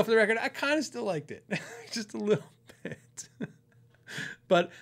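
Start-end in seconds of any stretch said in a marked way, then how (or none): 0.0-3.3 higher, laughing through the words; laughing admission. Real, not acted. Player admits he still liked the guitar, laughing. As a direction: confess it with a real chuckle.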